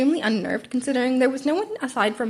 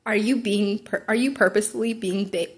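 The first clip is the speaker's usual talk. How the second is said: ordinary delivery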